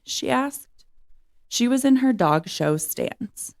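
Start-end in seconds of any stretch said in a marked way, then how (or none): none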